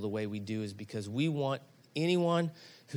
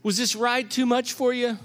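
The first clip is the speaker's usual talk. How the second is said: high, worried rush of questions; worried dad after the ride; anxious checking; 9 semitones higher, 4 dB louder than usual. Retold or imitated. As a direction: check on him frantically. Dad kneels checking dazed son.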